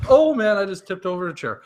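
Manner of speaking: loud, high startled outburst. Real, not acted. Host tells co-host he just tipped over a chair, startled. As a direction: jolt of surprise, voice jumping up.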